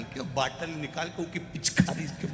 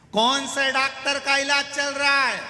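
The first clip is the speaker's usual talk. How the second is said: loud shouted question; 11 semitones higher, 6 dB louder than usual. Retold or imitated. Deaf visitor shouts question.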